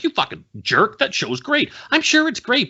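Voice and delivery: high-pitched mock outrage; indignant protest. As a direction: squabble with imagined angry fans, voice climbing. Acts out angry fans, defends himself.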